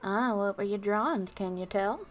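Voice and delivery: ordinary talk, nothing marked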